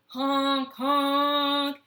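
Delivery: loud honking goose call. Acted. Goose honks at the woman reaching, loud honk. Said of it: "the goose yelled".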